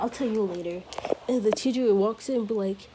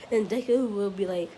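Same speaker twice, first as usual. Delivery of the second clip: ordinary delivery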